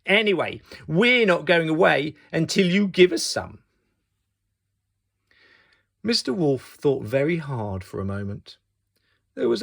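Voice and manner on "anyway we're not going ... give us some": louder, higher pushy threat; nasty insistence. Acted. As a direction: dig in nastily.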